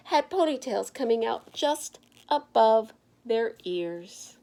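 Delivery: plain reading voice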